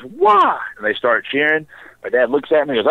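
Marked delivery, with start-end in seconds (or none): 0.0-0.6 a sudden, much higher, louder "Wah!" cry; crowd's wah cry. Retold or imitated. Bar crowd celebrating the chugged beer, loud high wah cry. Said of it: "the whole bar just goes".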